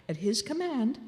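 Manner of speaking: ordinary delivery, nothing marked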